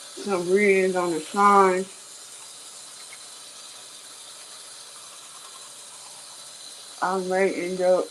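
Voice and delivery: talking through a toothbrush; while brushing teeth. Real, not acted. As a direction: mouth full of toothpaste. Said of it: "so I'm brushing my teeth".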